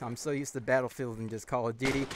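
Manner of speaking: usual speaking voice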